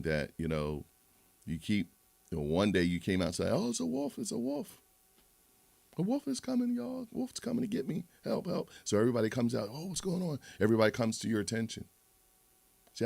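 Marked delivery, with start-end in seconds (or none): 3.5-8.7 put-on high, panicky falsetto boy; much higher mock cry for help; boy crying wolf. 9.7-10.4 villager. Acted. Villagers answer the boy's call.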